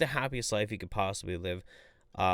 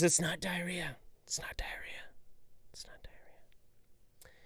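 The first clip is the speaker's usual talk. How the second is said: each repeat softer down to a slow whisper; fading repetition; 7 semitones higher, 9 dB quieter, slower than usual. Pretend. Reading a chat joke, fading to a whisper. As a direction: fade it out to a whisper. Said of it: "reading chat, fading out".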